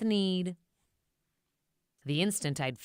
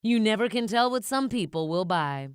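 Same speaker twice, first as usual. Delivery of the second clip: mocking Onceler; 7 dB louder than usual. A narrator's reading. Onceler jeers at the Lorax, loud and condescending. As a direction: talk down to him, amused. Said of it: "I laughed at the Lorax".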